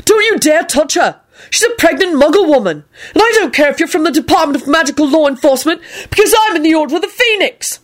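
high-pitched, forceful warning; angry shouting. A narrator's reading. Neville defending Elizabeth from the officials, shouting. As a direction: warn them off at a high, sustained pitch. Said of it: "shouted Neville".

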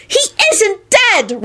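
high, drawn-out denial; slow, high-pitched fury; angry screech. A narrator's reading. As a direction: screech to shut him up. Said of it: "Rosie screeched".

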